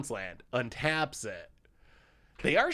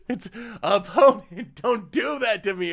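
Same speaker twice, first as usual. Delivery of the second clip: laughing protest; 10 semitones higher, 8 dB louder than usual. Real, not acted. Laughing at opponent play.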